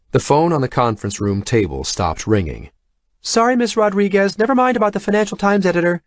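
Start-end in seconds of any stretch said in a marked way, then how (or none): 3.2-6.0 high, fast distant voice; from the hallway. A narrator's reading. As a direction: call from down the hall. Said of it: "came Tanya's voice from the hallway".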